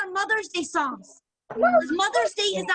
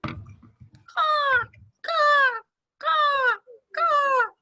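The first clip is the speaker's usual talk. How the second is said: high crow cawing; 8 semitones higher, much slower than usual. Shown as a demonstration. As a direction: caw like a crow. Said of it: "how about this one?".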